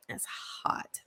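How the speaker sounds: real whisper about the hot narrator. Real, not acted. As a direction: hush it like a secret thrill. Gushing about the book's narrator.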